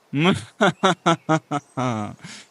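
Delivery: long evil cackle; evil laugh. Acted. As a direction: laugh menacingly like a villain. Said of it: "Zaśmiała się złowrogo".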